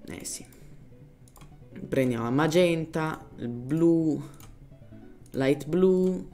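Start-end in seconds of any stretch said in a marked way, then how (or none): none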